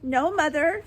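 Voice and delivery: high, frightened answer; trembling. A narrator's reading. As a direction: answer Mother in fear.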